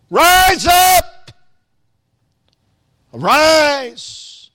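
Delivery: high, ringing shout of command; arousing power. Acted. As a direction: shout it out to rouse the listeners. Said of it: "an arousing power in that voice".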